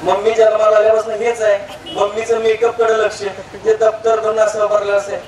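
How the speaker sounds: ordinary talk, nothing marked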